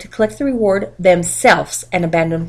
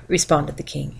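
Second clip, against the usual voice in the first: usual speaking voice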